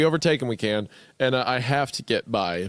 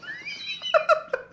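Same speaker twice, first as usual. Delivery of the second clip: high laugh; 3 dB quieter than usual. Real, not acted. Laughs at own attempt.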